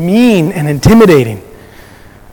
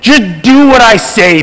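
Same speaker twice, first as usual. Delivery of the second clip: boss yelling; 3 semitones higher than usual. Retold or imitated. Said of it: "he's yelling at me".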